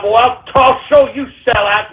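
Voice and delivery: normal talking voice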